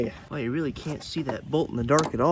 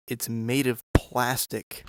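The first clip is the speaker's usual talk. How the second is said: ordinary delivery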